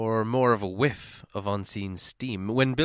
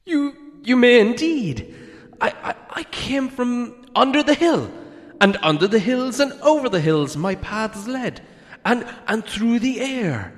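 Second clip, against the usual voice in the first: much higher, stammering hobbit voice; frightened hobbit flattering the dragon, high and nervous; 13 semitones higher, 7 dB louder than usual. A narrator's reading. Bilbo voice.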